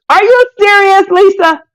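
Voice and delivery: high, loud, thrilled; delighted are-you-serious; excited disbelief. Real, not acted. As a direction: squeal at the news. Excited about magazine news.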